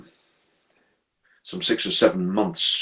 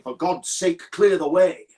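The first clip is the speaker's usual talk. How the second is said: high, urgent warning, louder at the end; 7 semitones higher, 5 dB louder, slower than usual. Acted. Signalman voices what the gesture says, urgent warning. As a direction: warn them off the track. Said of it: "as if someone was saying".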